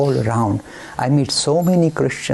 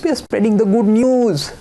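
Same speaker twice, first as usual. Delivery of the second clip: a light churchy sing-song; lightly mock the preacher's phrase; mimicking the minister; 6 semitones higher than usual. Quoting the ministers' words, in a light churchy sing-song. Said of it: "The language they use".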